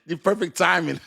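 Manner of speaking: high-pitched, through laughter; laughing. Real, not acted. Streamer tickled by chat's timing, laughing. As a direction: squeeze it out between laughs. Cracking up at chat teasing.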